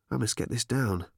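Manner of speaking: ordinary delivery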